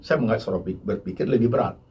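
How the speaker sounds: ordinary talk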